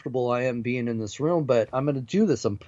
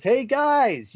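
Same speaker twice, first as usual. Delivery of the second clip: higher-pitched mock yell for help; retold scream; 10 semitones higher than usual. Guest reenacting yelling for the crew downstairs, higher mock shout. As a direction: call out as if they're far away. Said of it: "screaming at the top of my lungs".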